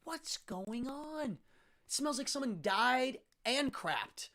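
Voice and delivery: mock horrified outrage; disgusted. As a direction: recoil from the stench. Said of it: "And I'm like".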